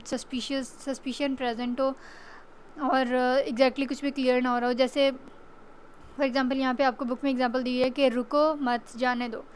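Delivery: ordinary talk, nothing marked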